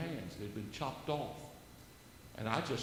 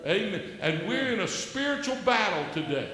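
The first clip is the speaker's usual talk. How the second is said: loud, higher preacher's proclamation; preaching at full force; 8 semitones higher, 7 dB louder than usual. Acted. Exhorting the host and viewers, loud high preaching. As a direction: proclaim it like from the pulpit.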